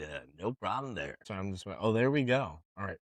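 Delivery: ordinary talk, nothing marked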